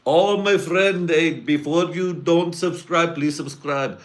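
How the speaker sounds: high, singsong mock Indian accent. Host begs viewers to subscribe, exaggerated Indian accent. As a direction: plead in a put-on Indian accent. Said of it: "sometimes I like to do Indian accents".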